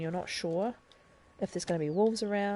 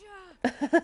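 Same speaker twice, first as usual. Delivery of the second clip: short laugh; 12 semitones higher, 6 dB louder than usual. Real, not acted. Laughs after the mishap.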